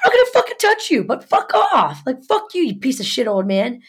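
very high, indignant rant easing down; re-enacted heated comeback; retold angry retort. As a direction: squeal in outrage, then spit the insult. Retold quarrel, answering his challenge.

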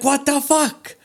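high squeaky disbelief; mock stunned, pitch jumping up; shocked. Tattooist retells hearing about Perry, high squeaky shock. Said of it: "And I'm like".

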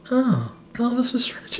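high, soft retold realization; credulous acceptance. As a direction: accept it innocently, no questions asked. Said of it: "just accepting what Corey said".

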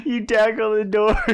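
ordinary talk, nothing marked

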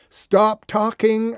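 slow, loud, high command. Retold or imitated. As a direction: bark the order to stop. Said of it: "I commanded".

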